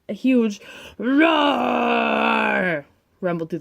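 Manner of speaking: roaring narration. Acted. Reading the big roar.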